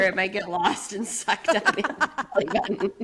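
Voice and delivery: talking through laughter; laughing. Real, not acted. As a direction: say it while laughing. Joking while laughing.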